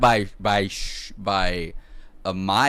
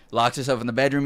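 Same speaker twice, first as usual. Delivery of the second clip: ordinary delivery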